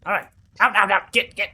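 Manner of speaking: loud high shoo. Acted. Mother NPC shooing the party down the stairs, loud. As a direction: chase them out loudly, high.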